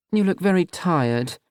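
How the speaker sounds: mother making a cutting remark slowly; disapproving mother. Acted. Mother eyeing her son's boyfriend, slow and pointed.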